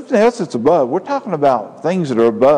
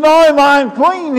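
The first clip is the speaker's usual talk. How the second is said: much higher, louder desperate cry; anguished confession; 13 semitones higher, 5 dB louder than usual. Acted. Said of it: "he said".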